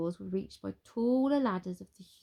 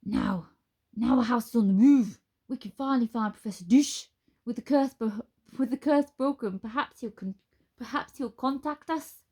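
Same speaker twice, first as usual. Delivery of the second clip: put-on a loud mixed-accent Spoon voice; 4 semitones higher, 6 dB louder than usual. A narrator's reading. Spoon to the wizard, in a mixed accent. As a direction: Spoon in a muddled Scottish-ish accent. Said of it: "a mix of all accents".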